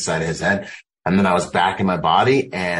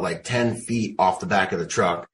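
usual speaking voice

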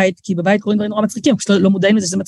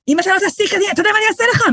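pitched way up, shouting the threat; dramatic screamed threat; 12 semitones higher, faster than usual. Retold or imitated. Mother replaying her dramatic screamed threat to her son, high yell. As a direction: scream the threat as if it's terrifying. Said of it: "אז איך זה היה נשמע?".

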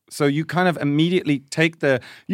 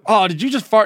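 higher, louder teasing question; mock accusing friend; 7 semitones higher, 4 dB louder than usual. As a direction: poke at the culprit. Friends notice fart, retold.

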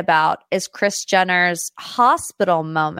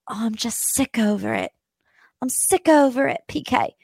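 put-on Dorit accent; 4 semitones higher than usual. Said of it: "I can't do the Dorit accent".

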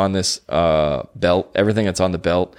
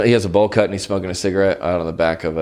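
ordinary talk, nothing marked

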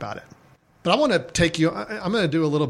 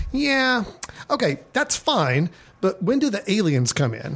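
higher studio executive voice, a bit quick; high-pitched nasal voice; 7 semitones higher than usual. Retold or imitated. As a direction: play the pushy studio suit, higher. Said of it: "a little more high-pitched, uh, nasally voice".

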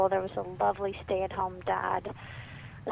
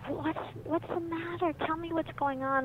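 soft, higher-pitched tender mom; gentled tone; 7 semitones higher, 4 dB quieter than usual. Retold or imitated. Mom kneels beside her upset little boy, gentle. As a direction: ask gently, coaxing an upset child. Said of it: "she kind of gentled her tone".

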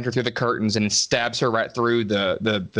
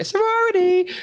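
incredulous; 4 dB louder than usual. Real, not acted. Mocking the sorority house.

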